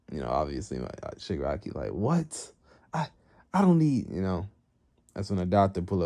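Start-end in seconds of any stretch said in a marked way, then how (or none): 1.9-4.1 high, stammering mock outrage; shocked protest. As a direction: blurt a disbelieving objection. Acted protest at master's remark.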